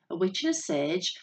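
ordinary delivery, nothing marked

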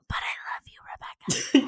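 very soft, voiceless imitation whisper; hushed, burned-vocal-cords whisper; creepy whisper. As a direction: whisper it creepily right into the mic. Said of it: "such a weird whisper".

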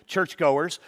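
usual speaking voice

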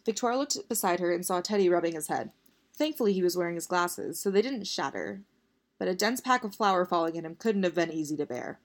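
usual speaking voice

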